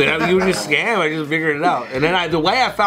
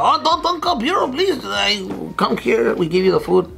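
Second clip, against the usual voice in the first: high, pleading worker voice, lightly hammed; mock pleading worker; 6 semitones higher than usual. Worker on the phone begging caller not to report, high mock pleading. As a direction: beg them not to call the bureau, hammed up. Mocking the restaurant worker's plea.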